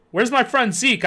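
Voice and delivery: high, frightened demand; shrill, frightened. A narrator's reading. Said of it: "I demanded in a shrill, frightened voice".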